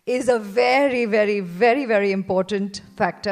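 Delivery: spelled-out emphasis on "very important factor"; emphatic very very very. Real, not acted. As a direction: lean hard on every "very". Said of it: "I'm spelling it aloud".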